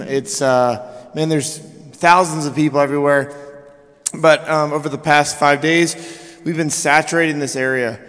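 normal talking voice

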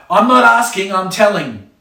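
a fired-up parent issuing a final order; threatening parent. Acted. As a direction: put your foot down hard. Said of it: "really fired up".

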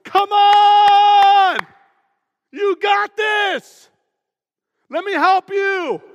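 high, shouted cheering, slowed for emphasis; big, loud rallying cry to a friend; hyped encourager. Pretend. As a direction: fire them up like a coach. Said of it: "naturally gifted at going".

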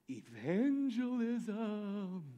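drawn out, very slow; slow reveal. Acted. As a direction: let each word hang. Revealing the scary word.

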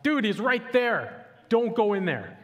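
high, loud, slow mock shout; shouting at the screen. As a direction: yell a warning at a movie character. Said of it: "you just wanna shout at your screen".

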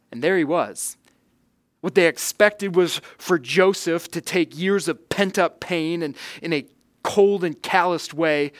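normal talking voice

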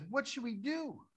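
slow, very high mock-panicked question; panicked servant. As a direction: pitch climbs in acted helplessness. Servant asks Elisha in alarm.

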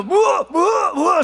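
high, loud mock yelps of shock; alarmed yell. Father imitating his son getting hit, alarmed yelling.

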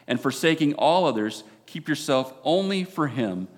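loud, fervent wedding sermon; preaching. Real, not acted. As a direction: preach it with full conviction.

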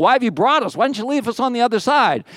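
high-pitched, protesting questions; pleading complaint. Acted. Acting out Joshua's complaint to God.

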